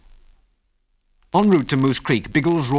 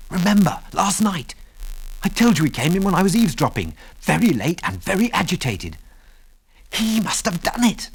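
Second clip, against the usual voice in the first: put-on higher, urgent young voice; tense, urgent; 9 semitones higher than usual. A narrator's reading. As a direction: press the point, alarmed. Ginger urgently accuses McBain.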